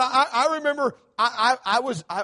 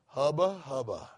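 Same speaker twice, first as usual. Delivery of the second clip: lower, softer lovestruck mutter; mock smitten; 7 semitones lower, 4 dB quieter than usual. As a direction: ogle her like a smitten young man. Groom seeing bride across room.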